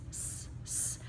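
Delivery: hissed unvoiced s sound; teacher modeling the s sound; letter sound s. Shown as a demonstration. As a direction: push out a soft hissing s. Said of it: "the S says s".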